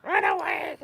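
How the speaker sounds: high panicked kobold squeal; squeaky yappy lizard chatter. Acted. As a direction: squeal it as you flee. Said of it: "my kobold voice".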